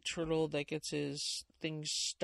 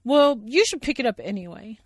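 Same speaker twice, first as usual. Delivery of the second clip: loud, higher scolding; Sally Bear voice; 7 semitones higher, 15 dB louder than usual. Acted. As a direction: tell him off like a big sister.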